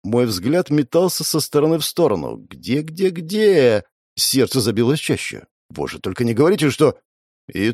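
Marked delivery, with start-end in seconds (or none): 2.4-3.8 frantic searching. Acted.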